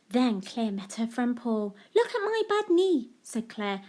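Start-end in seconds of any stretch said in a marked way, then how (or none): 2.0-3.1 put-on high little girl voice; high-pitched child's "look"; child showing knee. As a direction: show off the knee like an excited child. Claire's repeated line.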